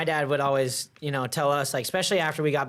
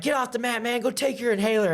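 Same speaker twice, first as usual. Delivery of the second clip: coach shooing; 9 semitones higher than usual. Retold or imitated. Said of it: "Kenny Johnson's like".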